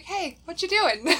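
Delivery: bright, pushy hello to a stranger; awkward eager greeting. Retold or imitated. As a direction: barge in, overly friendly. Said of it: "socially awkward Jess just kinda pops up and is like".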